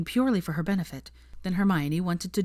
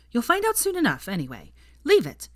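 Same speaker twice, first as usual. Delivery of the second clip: stern Ginny; 6 semitones higher than usual. A narrator's reading. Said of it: "giving her a stern look".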